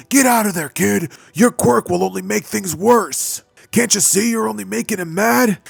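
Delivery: high, loud scolding shout; yelling. Acted. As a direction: yell at the kid to back off. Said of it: "the heroes would yell out".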